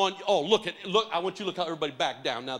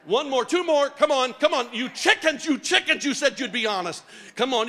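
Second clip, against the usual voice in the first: loud, high teasing call to come up; rally the hesitant crowd, loud and playful; 8 semitones higher, 9 dB louder than usual. Real, not acted. Pastor teasing the congregation to come up, calling out loud.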